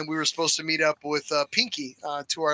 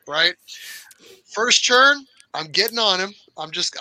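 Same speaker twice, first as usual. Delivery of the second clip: ordinary talk, nothing marked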